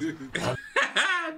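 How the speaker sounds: loud, high burst of laughter; real laughing fit; high-pitched laugh. Real, not acted. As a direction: crack up in a high cackle. Reactor cracks up at retold call.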